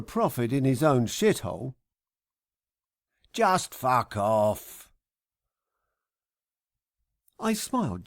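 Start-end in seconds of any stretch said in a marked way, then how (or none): 3.3-4.9 slow, heavy, contemptuous brush-off; slow dismissal. Acted. Parking guy's last word to the trucker, slow and contemptuous. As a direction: drag it out with disgust.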